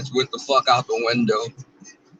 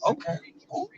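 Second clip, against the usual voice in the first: whispered; 13 semitones higher, 12 dB quieter than usual. Real, not acted. Unvoiced aside.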